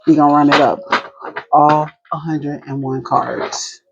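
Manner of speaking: ordinary talk, nothing marked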